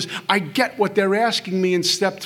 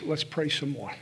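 low, soft guiding voice; gently nudge, hushed and low; quiet inner voice; 4 semitones lower, 10 dB quieter than usual. Acted. Godly voice giving guidance at the kitchen table, quiet. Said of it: "This is the voice that says".

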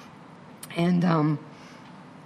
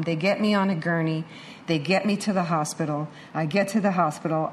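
normal talking voice

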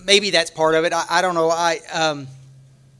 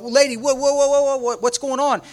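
higher, rattled run of repeated whoas; mock alarm, words tumbling out on one high note; retold startled protest; 7 semitones higher than usual. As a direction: act out being caught totally off guard. Retold protest at being pulled off.

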